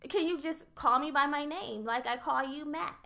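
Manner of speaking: ordinary delivery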